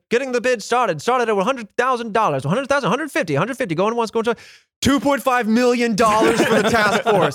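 fast high auctioneer patter. Pretend. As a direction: rattle off bids like an auctioneer. Mock auction bidding scene.

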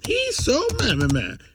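high, whiny troll voice; squeaky outraged troll rant; internet troll voice. Pretend. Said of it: "I can do it right here".

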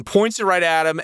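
ordinary delivery

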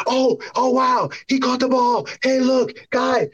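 high, delighted surprise; mock relief. Finally seeing his tight end catch it, high delighted cheer. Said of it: "I was just like".

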